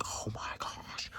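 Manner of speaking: slow, breath-only mock gasp; shocked whisper. Onlookers reacting to the student, mock awed whisper.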